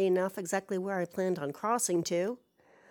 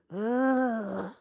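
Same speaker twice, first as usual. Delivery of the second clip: drawn-out, exasperated ugh; exasperated groan; 3 semitones higher than usual. Real, not acted. Telling of being spooked on errands, exasperated groan. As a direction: groan it out, fed up. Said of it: "That was me being exasperated".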